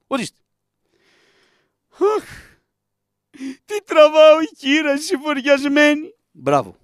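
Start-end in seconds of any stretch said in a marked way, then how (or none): 2.2-6.1 higher, louder mock widow's wail; mock mourning woman. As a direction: whine like a grieving neighbour woman.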